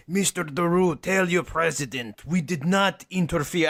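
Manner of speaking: put-on, dubious Russian accent; lightly hammed Russian official accent. Host playing a Russian sending his co-host a message, mock Russian accent. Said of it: "Is that your Russian accent?".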